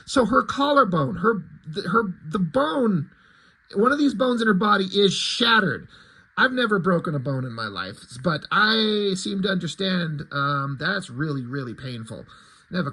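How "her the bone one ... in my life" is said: ordinary delivery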